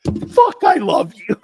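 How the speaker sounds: high, loud burst of love; proud dad's outburst. Retold or imitated. Dad to daughter after she bins the ribbon, proud delighted shout.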